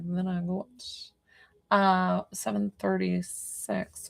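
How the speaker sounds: slow, soft hint of an English accent; mock English accent. Psychic mimicking her own accidental slip, mock English accent. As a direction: lightly put on an English accent, quietly. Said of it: "getting an English accent all of a sudden".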